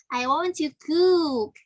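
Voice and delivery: "I want to cook" with a long, drawn-out "cook"; stress at the end. Shown as a demonstration. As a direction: lean slowly on the final word "cook". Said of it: "ini stresnya ada di belakang".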